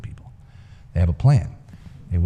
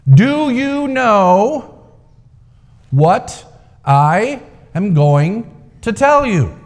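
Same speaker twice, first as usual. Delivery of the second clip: high, slow, drawn-out booming question; the old man proclaiming, slow and grand; strong booming voice; 10 semitones higher, 5 dB louder, much slower than usual. A narrator's reading. Old man addressing the crowd from the rock, booming.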